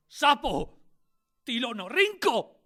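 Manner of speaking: loud, high, slow bitter cry; raging cry. Acted. Boy alone in the square cries out in rage. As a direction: fling the words after the vanished convoy. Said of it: "de murmurar con rabia".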